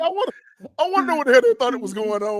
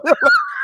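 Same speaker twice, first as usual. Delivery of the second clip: shrieking high laugh. Real, not acted. Friend cracking up at the lifeguard story, shrieking laugh. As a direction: crack up helplessly.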